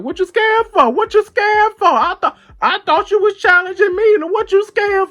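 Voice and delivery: high, loud, mocking squeal. Teasing her scared brother in line with a high, loud mocking squeal.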